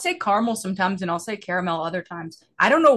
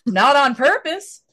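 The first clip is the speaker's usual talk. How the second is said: mock protest; 6 semitones higher, 7 dB louder, slower than usual. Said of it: "And I go".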